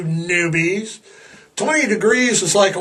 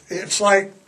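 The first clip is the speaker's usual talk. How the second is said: ordinary talk, nothing marked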